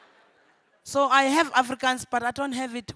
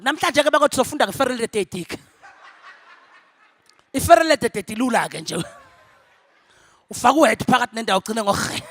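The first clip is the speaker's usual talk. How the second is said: lightly voiced Zulu teacher, fast and louder; imitating the teacher; 8 dB louder, faster than usual. Teacher rattling through an Afrikaans lesson in Zulu, fast and louder. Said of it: "The teacher was like".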